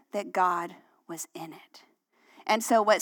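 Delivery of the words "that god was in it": awed whisper; whispered conviction. Real, not acted. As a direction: breathe it as a quiet certainty. Sermon emphasis on conviction.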